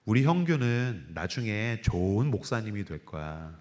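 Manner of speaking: gently foretell, stroking his hair; mother's tender words. Retold or imitated. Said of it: "저한테 이렇게 얘기하셨어요". (아들 머리 빗기며 하는 어머니의 부드럽고 느린 말)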